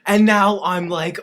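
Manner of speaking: ordinary talk, nothing marked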